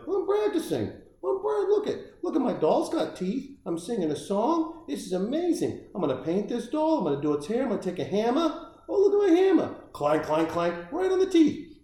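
put-on very high, childlike squeal; giddy elf voice. The elf playing dentist with his dolls, in a very high giddy voice. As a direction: squeal it like an excited little elf.

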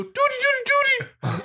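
very high honking doody; car horn honk. Pretend.

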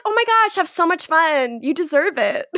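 high, gushing send-off, easing down in pitch; imitating Liv. Host as Liv hearing about a cabin trip, gushing. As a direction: pitch it up, sweetly encouraging. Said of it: "Liv's like".

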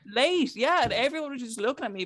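ordinary talk

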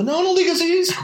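very high hammed-up wail; mock wailing crowd. Mourners protesting at the funeral, high mock wail. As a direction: shriek it like the mourners. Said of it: "e toda".

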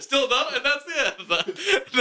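high, slow, laughing through words; cracking up at own joke. Real, not acted. Joking laugh.

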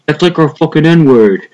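hammed-up angry complaint; Chris Cuomo, pissed off. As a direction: imitate an indignant mobster-style rant. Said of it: "got all pissed off".

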